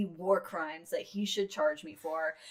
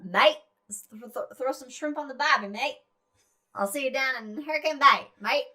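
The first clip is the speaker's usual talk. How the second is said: hammed-up Aussie cliché voice; Australian accent; 4 semitones higher, 3 dB louder than usual. Shown as a demonstration. Host trying out Australian accent despite being bad, mock Aussie. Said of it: "Australian is like".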